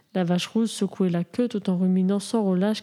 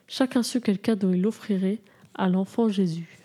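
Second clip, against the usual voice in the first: usual speaking voice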